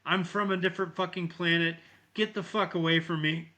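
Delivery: high-pitched mock outrage; worked-up imitation of a betrayed boy; retold fury. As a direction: act out a furious kid's outburst. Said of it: "He gets pissed".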